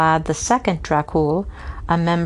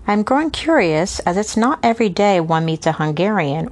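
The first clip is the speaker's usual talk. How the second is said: usual speaking voice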